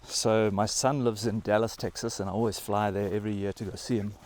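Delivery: ordinary talk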